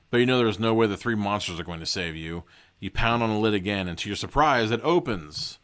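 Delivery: ordinary delivery, nothing marked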